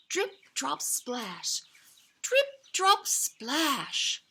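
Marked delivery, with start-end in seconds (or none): none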